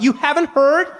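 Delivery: high-pitched incredulous gasp; mock disbelief. People disbelieving you missed the new [name]. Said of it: "they'll be like".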